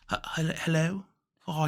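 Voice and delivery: put-on soft, hesitant British old man; lightly voiced elderly British caller; Britishy accent. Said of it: "Britishy accent".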